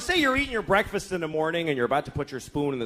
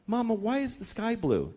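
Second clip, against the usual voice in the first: put-on child asking; 5 semitones higher, 5 dB quieter than usual. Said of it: "the kid asks a question like".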